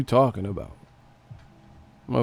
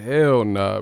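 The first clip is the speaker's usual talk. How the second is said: usual speaking voice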